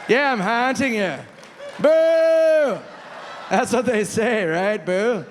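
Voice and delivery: hammed-up ghost wailing boo; ghost Norm. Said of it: "I would just hear".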